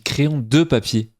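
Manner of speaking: slow, insisting on "de" in the phrase; stress on "de". Shown as a demonstration. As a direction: lean hard on the word "de", drawing the phrase out. Insists on own variant in word debate.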